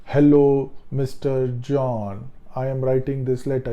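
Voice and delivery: stiff, formal, word-by-word greeting; stilted email reading. Shown as a demonstration. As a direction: speak as if reading out a written letter. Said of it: "You not start speaking like".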